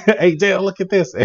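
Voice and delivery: high, loud retold holler; mock yell. Imitating Tucker yelling out to Dale on the lake, laughing yell. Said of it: "Tucker just yells out".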